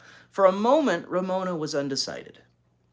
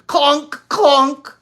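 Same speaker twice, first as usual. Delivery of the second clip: very high, loud shouted clonks; 14 semitones higher, 15 dB louder than usual. Acted. Little girl shouting pile driver noises. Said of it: "shouted Ramona".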